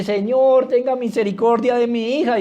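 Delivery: pleading. Acted. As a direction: imitate a mother begging for help. Imita la súplica de la mujer. (súplica actuada, tono agudo)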